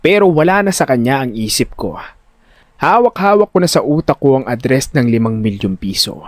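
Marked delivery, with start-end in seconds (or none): none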